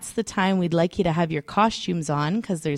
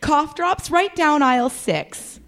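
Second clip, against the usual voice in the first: high laughing clerk; 7 semitones higher, slower than usual. Acted.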